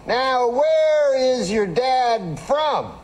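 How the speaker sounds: high, very slow, stretched Cosby voice; Bill Cosby impression. Cosby asking the fan about his dad, slow and high. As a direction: drag the question out like Cosby. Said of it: "He has that funny way of talking".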